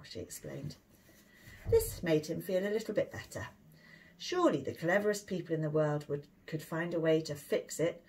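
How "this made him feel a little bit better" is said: plain reading voice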